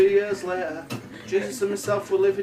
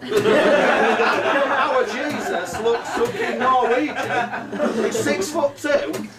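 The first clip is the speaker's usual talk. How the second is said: higher, worked-up comic rant; paranoid outrage; 5 semitones higher, 3 dB louder, slower than usual. Acted. Joseph challenging Mary over the baby's looks, acted outrage. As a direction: build into an incredulous, high protest.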